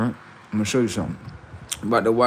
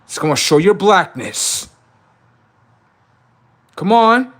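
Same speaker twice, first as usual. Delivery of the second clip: loud, high taunting cry; high mocking shout of come on; 9 semitones higher, 7 dB louder than usual. Real, not acted. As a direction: rile them up, yelling.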